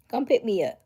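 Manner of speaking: loud, high, half-crying plea; excited plea. Real, not acted. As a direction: beg playfully to be taken along.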